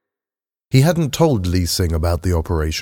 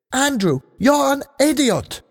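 higher, exasperated cry; angry outburst. A narrator's reading. Lee Sing calling Andrew an idiot, higher exasperated cry. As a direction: fling it at him, much higher.

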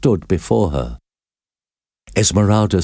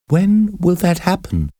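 Esmeralda voice; 11 semitones higher than usual. A narrator's reading.

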